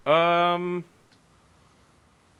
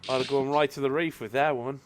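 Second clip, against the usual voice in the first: mock Australian. Player imagines an Australian Tengu, mock Australian accent. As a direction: leisurely fake Aussie accent. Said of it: "I was this close to making an Australian tango".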